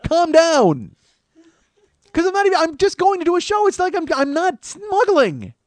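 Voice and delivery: very high, loud, squeezed panic; an inner voice spiralling into panic; freaking out. Real, not acted. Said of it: "'cause I'm freaking out".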